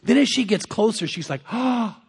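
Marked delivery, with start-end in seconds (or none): 1.5-2.0 imitated gasp of delight; mock-excited little "oh"; retold excited gasp. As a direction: gasp as if excitedly spotting a chance. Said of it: "she is so excited".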